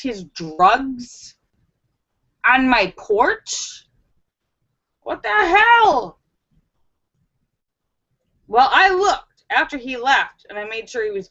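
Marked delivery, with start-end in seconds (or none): 5.0-6.2 high, drawn-out disbelief; incredulous outburst. Retold or imitated. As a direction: stretch it out, high and baffled. Disbelief at the intruder's excuse.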